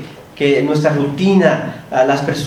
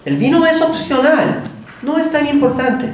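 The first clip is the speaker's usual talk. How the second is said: mature inner voice; 9 semitones higher than usual. Retold or imitated. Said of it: "la parte más madura me dijo".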